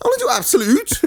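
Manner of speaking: high, mock-earnest Scottish child; Scottish kid. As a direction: imitate a little boy with a Scottish accent. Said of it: "it's a Scottish kid".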